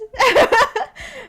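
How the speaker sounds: high loud laugh; burst of laughter. Real, not acted. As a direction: laugh right through the sentence. Laughs mid-sentence about disliked thing.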